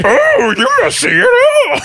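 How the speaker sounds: very high, booming puppet shout; too loud. Acted. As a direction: bellow it in a huge hammed-up voice. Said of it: "That's too loud".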